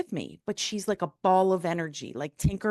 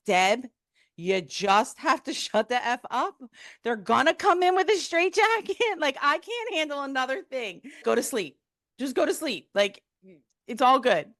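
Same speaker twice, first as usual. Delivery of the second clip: high, strained mock exasperation; exasperated husband; 6 semitones higher than usual. Husband begging his wife to sleep, exasperated. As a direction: act out a husband at wit's end, pitched high.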